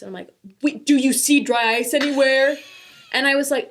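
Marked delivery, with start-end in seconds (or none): 0.6-2.6 loud, mock-incredulous retort; sarcastic jab at husband about dry ice. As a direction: throw the question back at him sarcastically. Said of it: "also being sarcastic".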